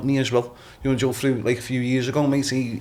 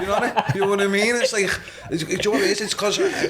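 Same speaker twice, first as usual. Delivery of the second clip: laughing; 8 semitones higher, faster than usual. Real, not acted. Laughing after his mock challenge.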